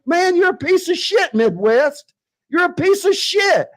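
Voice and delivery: pitched high in real rage; furious insult. Real, not acted. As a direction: spit the insult at him. Raging insult at the streamer.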